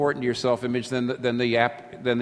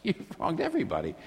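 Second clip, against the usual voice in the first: softer, with a laugh, savouring the line; amused repeat; 6 semitones higher, 4 dB quieter than usual. Real, not acted. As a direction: echo it quietly through a chuckle.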